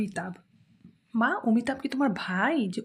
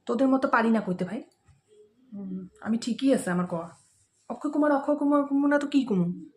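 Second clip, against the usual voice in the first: ordinary delivery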